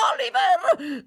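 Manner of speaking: high, loud cry; shrill-pitched crazed call. A narrator's reading. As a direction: shout the boy's name urgently.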